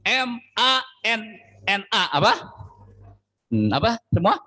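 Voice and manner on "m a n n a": M A N N A spelled slowly, letter by letter, high; spelled out manna. Shown as a demonstration. Preacher spelling the bread's name for the congregation, slow and high.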